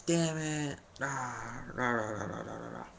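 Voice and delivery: high, hammed-up frustrated grumble; playful fake tantrum, rah rah rah; mock frustrated rant. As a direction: act out the fuss as a half-joking tantrum. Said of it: "so I was like".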